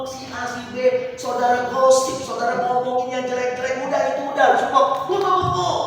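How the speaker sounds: high, loud preaching that climbs to a cry; fiery preaching. Acted. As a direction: drive the message home to the church. Preaching at full force.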